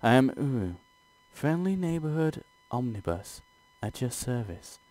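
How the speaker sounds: lightly voiced bowing demon lady; omnibus introduction. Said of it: "She gives you a theatrical little bow".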